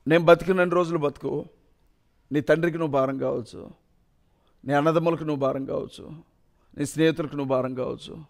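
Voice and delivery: soft, low, tender mother's plea after crying; mother's tearful comfort. Retold or imitated. Mother to grieving son, soft tearful reassurance. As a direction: comfort your son quietly, as if through tears. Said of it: "ఏడ్చి".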